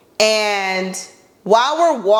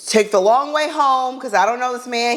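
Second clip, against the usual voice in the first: ordinary delivery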